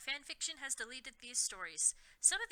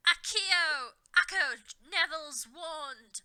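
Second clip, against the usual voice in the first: higher, louder, slow spell cry; cried out; 4 semitones higher, 7 dB louder, slower than usual. A narrator's reading. Voldemort summoning the wand, loud spell cry. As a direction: call the spell with force. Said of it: "cried Voldemort".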